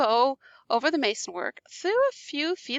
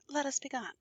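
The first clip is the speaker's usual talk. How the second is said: much softer, flat mocking repeat; cold echo; 11 dB quieter than usual. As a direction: repeat his words back quietly and coldly. Montresor coldly echoing.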